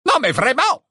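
high, loud outraged cry; indignant exclamation. A narrator's reading. Armando Dippet's portrait protesting the breakage, indignant. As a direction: burst out scandalised. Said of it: "la tête d'Armando Dippet s'exclama".

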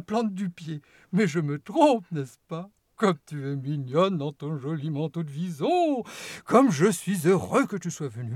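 a showman's welcome, sweet and slightly mocking; gleeful chocolatier greeting his guests; eccentric host. Wonka greets Veruca and parents.

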